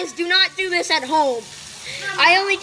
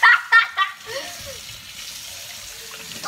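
high, bursting laugh of relief; real laughter; 9 semitones higher than usual. Real, not acted. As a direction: laugh out after surviving it. Laughing after the challenge.

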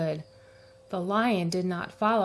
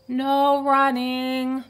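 loud, slow warning call; loud, drawn-out reminder; calling after; 5 semitones higher, 6 dB louder, much slower than usual. A narrator's reading. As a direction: call the rule after him. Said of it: "Miss Merriweather called after him".

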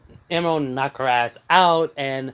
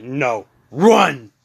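urgent order; 5 dB louder than usual. Acted. Urgent order in acted exchange.

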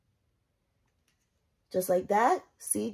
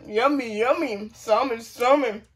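mock TikTok yummy; 4 semitones higher, slower than usual. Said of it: "Do y'all watch that TikTok? And they be like".

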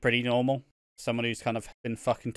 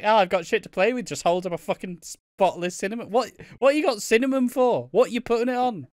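higher, quick mocking squeak; higher, quick mocking squeak for the character; 8 semitones higher than usual. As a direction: ham up a daft excited character. Mock voicing a character.

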